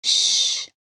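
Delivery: unvoiced hushing shh; shush. Man silencing the frightened girl, a whispered shh. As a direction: hush her with a sharp shh.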